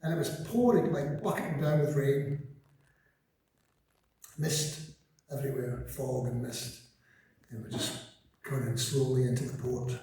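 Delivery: ordinary talk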